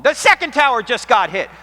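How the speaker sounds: loud, high alarmed yell down the phone; retold scream. Pastor screaming into the phone, loud and high. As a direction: shout the news in shock. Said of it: "suddenly he screams into the phone".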